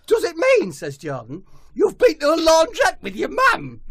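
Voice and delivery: high, loud scouse footballer voice; high-pitched footballer caricature; Jordan Henderson voice. Acted. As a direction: squeal it like an overexcited footballer. Jordan guessing.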